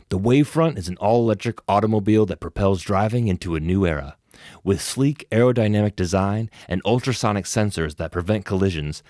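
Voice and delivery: car commercial voiceover read. Pretend. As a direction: sell the car, smooth and punchy. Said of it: "do an actual voiceover script".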